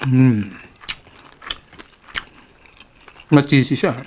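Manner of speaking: chewing while talking; humming between bites; mouth full. Real, not acted. Seen 1: Lips closed and working, wing held at mouth throughout the words.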